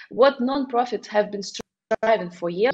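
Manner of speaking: ordinary talk, nothing marked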